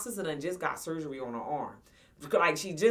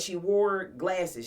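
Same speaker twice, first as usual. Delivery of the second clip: usual speaking voice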